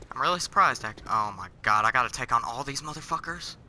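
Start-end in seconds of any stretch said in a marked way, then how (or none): none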